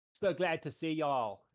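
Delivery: chef's greeting slipping into an Australian drawl, slow; Southern welcome that drifts Australian; accidental Australian accent. Acted. GM voicing the chef, accent slipping Australian. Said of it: "you suddenly turned Australian".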